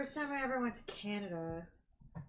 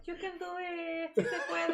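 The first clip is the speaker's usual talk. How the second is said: cheering quote; 7 semitones higher than usual. Retold or imitated. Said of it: "Jimena, like".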